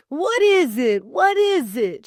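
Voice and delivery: high, loud, alarmed calling; a man pounding on the door, calling out; shouting. Acted. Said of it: "shouted a familiar voice".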